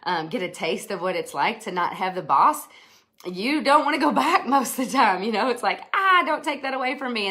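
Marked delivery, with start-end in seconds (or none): 3.2-5.8 laughing. Real, not acted. Laughs while saying it. 5.9-7.3 mock plea.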